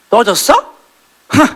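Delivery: mock Chunhyang scoffing at him; scornful scoff. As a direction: brush him off with a snort.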